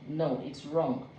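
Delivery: normal talking voice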